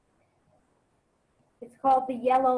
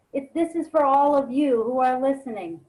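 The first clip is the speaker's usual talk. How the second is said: normal talking voice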